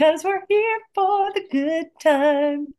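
high, slow sing-song catchphrase; playful sing-song. Pretend. Co-host riffing on a party motto, slow and sing-song. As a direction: stretch it out like a cheerful jingle.

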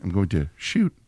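low, soft, haltingly stilted deadpan; odd pauses, dropping deep on the last word; Neil Breen. Acted. Trying odd inflections as Breen, low and stilted. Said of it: "is to have odd inflections".

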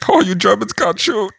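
put-on light German accent heckle. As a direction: tease them in a hint of a German accent. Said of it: "heckling Germans in a German accent".

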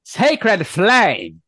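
high, loud spell incantation; yelled battle cry. Acted. Acolyte casting at an enemy, a high shouted cry. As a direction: yell it out like a combat spell. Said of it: "And he yells out".

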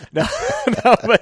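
breaking into high laughter; real laughter cutting through the words; laughing. Real, not acted. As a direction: laugh it off after the bit. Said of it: "laughing after the impression".

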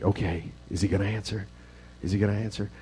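soft, drawn-in wondering, barely above a murmur; hushed suspense. Pretend. Preacher playing the dramatic pause at the lions' den, hushed. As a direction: hold your breath and wonder. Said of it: "I think a dramatic pause would be good".

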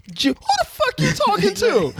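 laughing. Retold or imitated. Mock stern parent, laughing.

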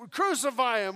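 loud high shout of the mob; mock crowd yell. Preacher shouting the crowd's cry at his church. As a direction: bellow it like an angry crowd. Crowd yelling in sermon retelling.

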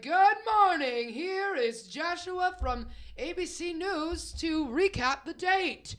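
slow, loud, high mock anchorman; newscaster voice. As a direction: read it like a pompous TV anchor. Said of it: "of him being a newscaster".

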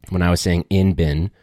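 ordinary talk, nothing marked

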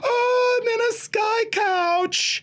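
very high, drawn-out acted delight; ecstatic squeal. Passenger discovering her Sky Couch, ecstatic high squeal. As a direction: squeal slowly with overblown wonder.

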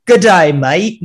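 a quick hammed-up stab at Australian; Australian accent attempt. Host teased about his trip tries a mock Aussie accent. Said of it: "No, that's Australian".

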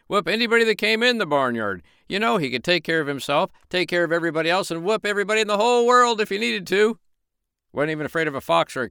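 high, building mock brag; mock boast. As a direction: puff up like the rooster. Storyteller mimics rooster's boasting.

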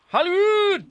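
very high, loud showbiz call; hammed-up high holler; mock fanfare. Mock fanfare on guest's location.

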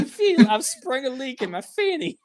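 high, loud hammy wife; mock wife voice. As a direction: squeal it like a panicked wife. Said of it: "is she like".

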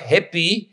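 "hippie" said as HIP-pee, no h dropped; spell-out reading "hippie" as in hippie; misspelled pronunciation. Shown as a demonstration. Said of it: "it would be H I P P I".

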